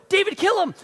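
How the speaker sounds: high, loud urging; urgent shout. Acted. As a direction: bark the order up high. Men urging David to kill.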